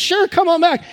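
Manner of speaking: the same breezy welcome, brighter; cheery clerk. Retold or imitated. Pastor repeating the licence office's cheery invitation.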